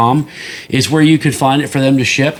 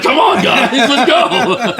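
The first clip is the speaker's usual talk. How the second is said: eager coaxing; 10 semitones higher, much slower than usual. Retold or imitated. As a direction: egg the guys on, laughing. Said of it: "He's like".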